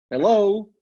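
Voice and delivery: high, loud, incredulous hello; pointed hello. Real, not acted. Immigrant challenging listeners about excuses, pointed hello. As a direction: call it out like a wake-up. Pointed check after second-language admission.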